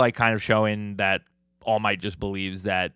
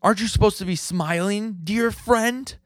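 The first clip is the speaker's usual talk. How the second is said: high, loud hammed-up hero taunt; mock hero shout; 13 semitones higher, 3 dB louder than usual. Vlogger voices All Might taunting the villain, high hammed-up shout. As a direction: pitch it way up and yell it like the hero. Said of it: "and All Might goes".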